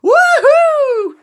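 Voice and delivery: high, loud laugh into a whoop; exultant "ha ha, woohoo"; triumphant whooping. A narrator's reading. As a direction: whoop with joy at full voice. Falcon exulting in first flight.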